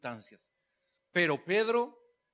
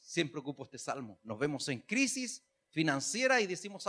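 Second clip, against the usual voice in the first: ordinary delivery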